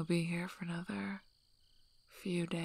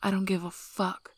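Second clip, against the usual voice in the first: sudden angry outburst, louder, whisper breaking into force; 4 dB louder than usual. Acted. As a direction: snap at her, furious she wants to stay. Angry outburst after she refuses.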